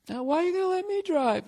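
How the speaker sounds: very high mock girlfriend; put-on girlfriend voice. Acts out girlfriend questioning him.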